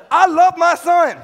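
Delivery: normal talking voice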